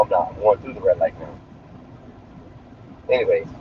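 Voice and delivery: mumbling low and soft to himself; half-heard muttering while driving; quiet mumble. Real, not acted.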